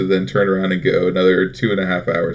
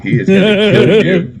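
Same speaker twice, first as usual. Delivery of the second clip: sudden high, loud yell of the warning; mock warning yell; 14 semitones higher, 10 dB louder than usual. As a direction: blurt it out as a wild warning, much higher and louder. Dad warning about shelter dog.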